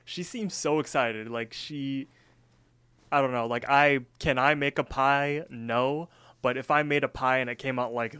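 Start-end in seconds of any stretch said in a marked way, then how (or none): none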